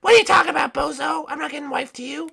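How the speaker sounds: high, quick princess rebuff; high cartoon princess, unimpressed; dismissive princess. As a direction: turn him down in a high princess voice. Princesses refuse the proposal.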